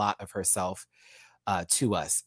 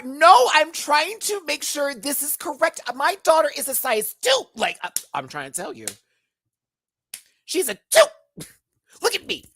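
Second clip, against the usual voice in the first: high, loud insistent mom voice; pushy mom bit; 12 semitones higher, 6 dB louder than usual. Pretend. Mom insisting to the clerk her daughter is a size two, high and loud. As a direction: insist like a proud pageant mom.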